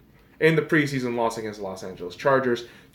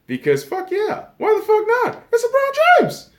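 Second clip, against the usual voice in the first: squealing much higher, rising giddy excitement; fangirling; 5 dB louder than usual. Real, not acted. Vlogger fangirling over LeBron James on camera. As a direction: gush like a starstruck fan.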